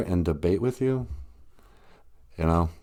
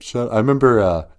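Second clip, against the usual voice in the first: normal talking voice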